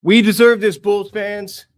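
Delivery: loud, high victory yell; a real shout of triumph; real excited shout. Real, not acted. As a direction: yell it out to the fans. Fan celebrating draft lottery win.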